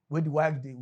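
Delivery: ordinary delivery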